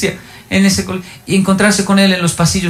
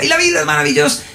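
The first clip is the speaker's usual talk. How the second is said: remate agudo del maestro eufórico; grítalo agudo, como quien salta de alegría; grito imitado; 10 semitones higher, faster than usual. Retold or imitated. (pregón agudo e imitado)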